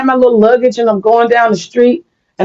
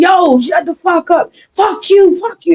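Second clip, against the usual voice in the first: retold yelling; 10 semitones higher than usual. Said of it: "they was yelling to the top of their lungs".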